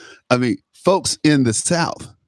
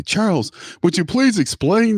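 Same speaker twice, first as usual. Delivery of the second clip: imitating a questioner; 10 semitones higher than usual. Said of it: "as I always say to people when they say".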